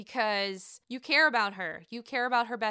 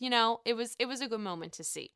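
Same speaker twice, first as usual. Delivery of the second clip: ordinary delivery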